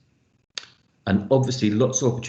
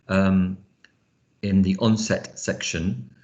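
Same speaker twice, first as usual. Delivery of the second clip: usual speaking voice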